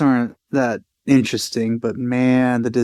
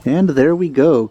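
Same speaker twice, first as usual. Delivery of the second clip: ordinary talk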